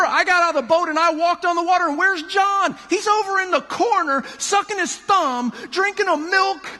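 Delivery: high, fast, excited tattling voice; jealous Peter. Retold or imitated. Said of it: "Peter says".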